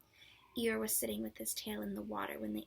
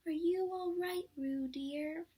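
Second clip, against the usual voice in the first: higher, louder worried mother's call; anxious call; 7 semitones higher, 6 dB louder, slower than usual. A narrator's reading. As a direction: call out anxiously to your child. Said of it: "called Kanga anxiously".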